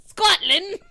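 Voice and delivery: Scotland in a loud, high, hammed-up Scottish accent; mock Scottish accent. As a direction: punch out Scotland like a bad Scottish impression. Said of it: "that's more aggressive".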